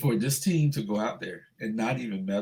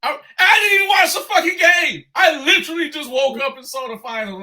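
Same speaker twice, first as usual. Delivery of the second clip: much higher and louder, still fuming; upset outburst; 13 semitones higher, 7 dB louder than usual. Real, not acted. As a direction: let the anger spill over in disbelief. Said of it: "I'm literally upset".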